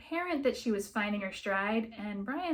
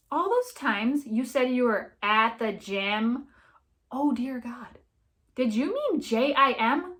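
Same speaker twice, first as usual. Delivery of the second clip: louder, slow and spelled out; too loud; 3 semitones higher, 6 dB louder, slower than usual. Acted. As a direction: let the whole restaurant hear it. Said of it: "please don't say that so loud".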